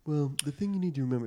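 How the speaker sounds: pompous actor impression. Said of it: "he was being such a actor douche".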